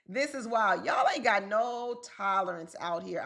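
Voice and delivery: ordinary talk, nothing marked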